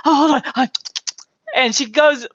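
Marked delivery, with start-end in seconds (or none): 0.0-0.7 higher, breathless hold-on; mock breathless panic. Imitating a guest hyperventilating mid-talk, mock breathless. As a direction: act out a flustered speaker catching her breath. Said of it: "starts hyperventilating again".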